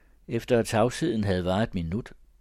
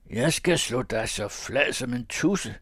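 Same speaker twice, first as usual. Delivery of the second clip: a higher, louder mock threat; threatening shout; 4 semitones higher, 3 dB louder than usual. A narrator's reading. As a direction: threaten to flatten him. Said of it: "råbte Thomas".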